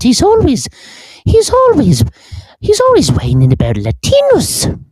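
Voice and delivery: put-on very high prim elderly woman gossiping; old British lady voice. Mocks Leguizamo in old-lady voice.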